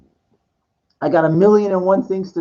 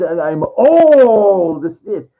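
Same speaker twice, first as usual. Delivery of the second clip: very slow, louder dark-lord declaration; slow villain boast; 5 dB louder, much slower than usual. Retold or imitated. Mocking the villain's slow grand boast. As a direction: draw it out like an evil emperor.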